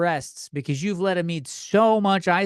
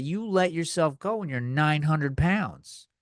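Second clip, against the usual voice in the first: ordinary delivery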